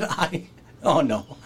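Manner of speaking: laughing helplessly; can't stop laughing while telling it; real laughter. Real, not acted. Storyteller cracking up at the memory, laughing.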